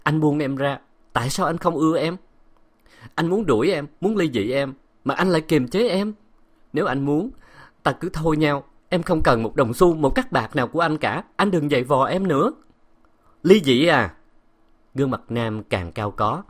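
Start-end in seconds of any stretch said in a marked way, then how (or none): 0.0-12.5 a higher, hurt wife begging to be let go; pleading wife. A narrator's reading. 13.4-14.1 louder, incredulous husband; shocked retort. A narrator's reading. Husband hears divorce demand.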